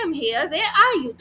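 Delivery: slow, drawn-out answer "I am here", swinging in pitch; model dialogue intonation. Shown as a demonstration. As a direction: demonstrate "where are you" as a question with a big falling tune. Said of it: "सवाल जैसे पूछा जाता है, उस तरीके से जवाब".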